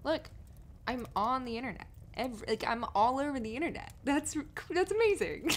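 high, loud, rushing excitement; elated. Acted. Telling partner about internet fame, elated. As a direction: burst out with giddy joy.

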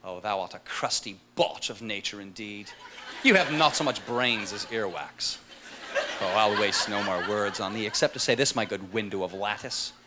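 put-on low, slow and grand, a theatrical old-English insult; Shakespearean gentleman. Shakespearean man trading insults with a modern guy, lofty and slow. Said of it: "Here's the guy from Shakespearean times".

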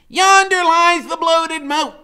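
very high, loud, slow; Freak's archaic voice. A narrator's reading. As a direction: proclaim it like a herald. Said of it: "Freak keeps saying stuff like".

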